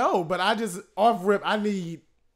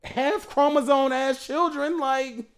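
heated mockery; 8 semitones higher, 5 dB louder than usual. Real, not acted. Heated Game of Thrones argument.

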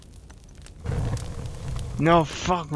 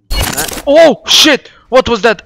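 loud, high startled cry; sudden alarmed outburst; 8 semitones higher, 9 dB louder than usual. Real, not acted. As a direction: jump and swear in shock. Startled by a wrong key.

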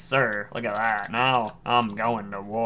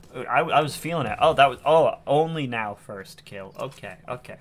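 ordinary talk, nothing marked

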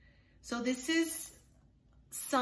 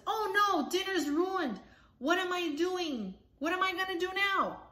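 high, fretful granny voice; flustered grandma; 8 semitones higher than usual. Acted. Grandma panicking over ruined dinner, high and flustered. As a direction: throw up your hands, dinner's burnt.

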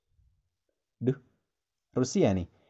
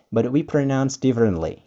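ordinary talk

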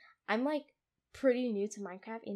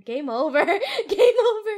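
loud, high, laughing cry; laughing yell; 8 semitones higher, 13 dB louder than usual. Real, not acted. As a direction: shout it while cracking up. Laughing at own death risk.

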